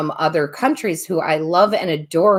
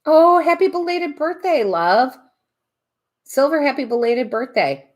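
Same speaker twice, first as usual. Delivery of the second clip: high, bright-eyed happy birthday; sing-song excited greeting; 10 semitones higher than usual. Real, not acted. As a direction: gush it out to a viewer. Greeting a viewer warmly.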